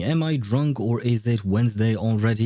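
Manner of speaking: ordinary talk, nothing marked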